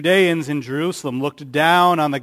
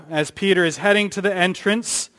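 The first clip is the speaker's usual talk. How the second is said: usual speaking voice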